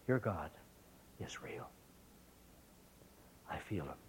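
hushed, broken whisper through tears; a girl awed after crying, whispering faith; tearful whisper. Retold or imitated. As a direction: barely voice the words, overcome. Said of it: "looked up through her tears".

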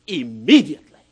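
loud, falling "Immediately."; preacher driving home "Immediately."; forceful. Acted. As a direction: hit "Immediately." hard and drop it. Preacher repeats word for emphasis.

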